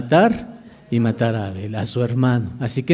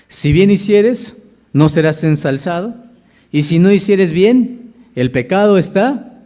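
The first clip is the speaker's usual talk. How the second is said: forceful preaching; 4 semitones higher, 4 dB louder than usual. Acted. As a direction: hammer each phrase of the warning home. Preacher reads God's warning.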